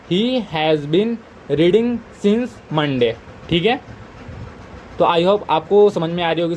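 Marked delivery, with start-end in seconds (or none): 0.1-3.1 very slow, each word laid out; unhurried classroom dictation. Shown as a demonstration. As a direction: read it slowly for students to copy. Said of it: "teacher dictating finished sentence".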